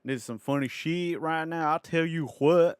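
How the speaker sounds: put-on Southern American accent. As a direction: play the amused Southerner, hammed up. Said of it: "this really shitty Southern American accent".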